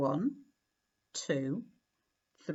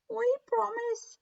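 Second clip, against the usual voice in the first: very high squeaky mouse promise; little mice voices. A narrator's reading. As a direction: promise like tiny earnest mice. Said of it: "said the mice".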